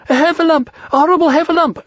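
very high, loud squealed alarm; panicked cry. A narrator's reading. Piglet fleeing the Heffalump, high panicked cry. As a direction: raise the alarm in a tiny terrified voice. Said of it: "cried Piglet".

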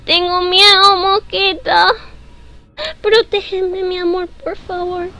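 high, scared pleading voice; asustada, mimosa. Pretend. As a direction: plead playfully for protection from a pet. Pide protección asustada al jugar. (súplica aguda y asustada, medio en broma)

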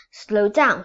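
loud shout at the horse. Acted. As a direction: yell to rein the horse in. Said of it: "he shouted".

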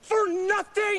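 outraged. Acted. Nick, told he's worked up over nothing, fires back outraged. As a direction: hit "For" hard in disbelief.

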